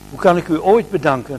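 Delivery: put-on young mother thanks him, bursting with joy; happy cry. A narrator's reading. Young mother thanking the shoemaker, calling out happily. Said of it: "riep ze blij".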